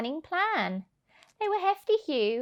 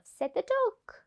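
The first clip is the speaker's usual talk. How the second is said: plain reading voice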